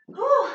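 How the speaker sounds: sharp high breathy whew after the set; winded exhale. Real, not acted. Instructor to class after jumps, winded whew. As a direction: blow out hard, out of breath. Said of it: "I'm out of breath".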